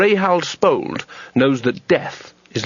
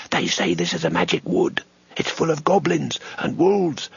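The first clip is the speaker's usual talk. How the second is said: hushed, uneasy whisper; nervous whisper; 4 semitones higher, 3 dB quieter than usual. A narrator's reading. Junior wizard frets about the magic wood, whispering. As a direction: spook yourself in the dark.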